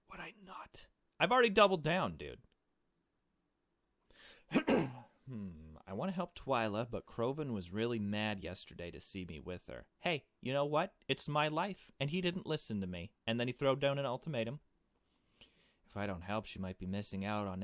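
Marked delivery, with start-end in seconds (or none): 0.1-0.9 breathy unvoiced whisper; a quick whispered aside. Real, not acted. Whispered aside while playing. 1.2-2.4 loud and higher, pushing back; loud, high defiant outburst. Real, not acted. 10.0-14.6 louder, higher and fast by the end; fired-up rant against him; defiant rant. Real, not acted. Defiant rant about Kroven.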